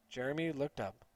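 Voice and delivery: ordinary delivery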